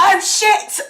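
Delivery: high, loud shock at being found out; mock alarm. Passenger caught as a Russian asset, mock alarm. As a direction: yelp as if the cover is blown. Passenger's spy cover is blown.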